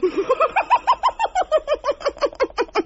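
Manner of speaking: long high helpless giggle; derisive laughter. Acted. Debbie asked about Jenkins and Lucretia, helpless laughter. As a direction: answer with nothing but high laughter. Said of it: "I agree, it's laughable".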